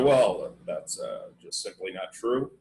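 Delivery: a light take on a cool debater brushing off a claim; imitating Dick Cheney. Mimicking Cheney dismissing his rival in debate, low and quiet. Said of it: "and he go".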